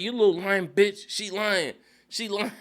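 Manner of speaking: high, heated imitation breaking into laughter; comic indignant outburst; mock accusation. Acted accusation in a family quarrel.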